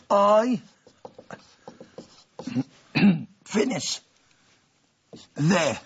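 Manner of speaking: slow word-by-word dictation, each word set apart; spaced classroom dictation; dictation pace. Shown as a demonstration. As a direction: dictate it so students can write along.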